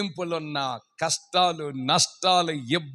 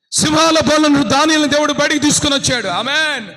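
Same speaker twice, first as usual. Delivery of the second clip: high, loud proclamation; loud preaching; 10 semitones higher, 9 dB louder than usual. Acted. Preacher telling of Daniel, loud and high. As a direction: proclaim it to the crowd.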